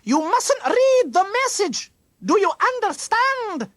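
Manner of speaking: very high, loud, stern shout; sudden shout. A narrator's reading. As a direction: shout a sharp warning at the children. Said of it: "Miss McBride suddenly shouted".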